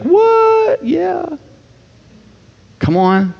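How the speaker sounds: yelped high squeal of disbelief; shocked delight. Retold or imitated. Preacher imitates a forgiven debtor, squealing high. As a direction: shriek up in thrilled surprise. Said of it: "How many of you would be like".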